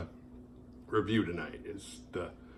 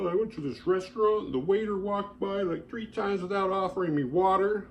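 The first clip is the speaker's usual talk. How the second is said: mock entitled complainer; 6 semitones higher, 4 dB louder than usual. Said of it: "You know, like".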